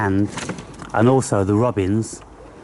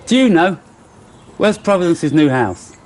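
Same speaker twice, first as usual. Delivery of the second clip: higher, louder pet-talk question; playful talk to pets; 7 semitones higher, 4 dB louder than usual. Real, not acted. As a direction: ask the squirrel playfully. Asking the squirrels playfully.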